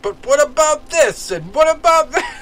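high, loud, excited mock challenge; mock badgering. Imitating a friend grilling a street preacher, high and badgering. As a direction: pester him with loud high-pitched questions.

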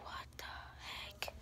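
slow, spooked whisper of the moment; hushed whisper. Retold or imitated. To viewers, reliving the creepy noise, in a slow whisper. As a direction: breathe it out, unsettled.

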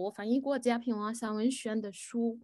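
normal talking voice

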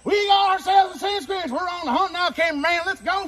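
high, loud rallying shout; excited shouting. Acted. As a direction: rouse the team to the hunt. Rallying the hunt.

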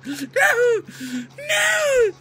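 high crying shouts for Nino; hammed-up grief over a fallen friend; mock anguished cries. Teammate knocked out, mock grief.

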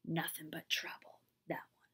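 hushed, disapproving whisper; whispered gossip. Acted. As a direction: whisper it behind her back. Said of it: "They shook their heads and whispered".

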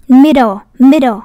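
"Middle" with MID loud, then falling; slow "middle", punch MID each time; stress on first syllable. Shown as a demonstration. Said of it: "cũng là dấu sắc luôn".

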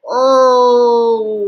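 long, loud, sustained "oh"; drawn-out cry of dismay; despairing groan. Real, not acted. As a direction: groan it out at length. Despairing at the slap.

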